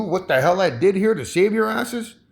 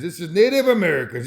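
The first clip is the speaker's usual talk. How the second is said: ordinary talk, nothing marked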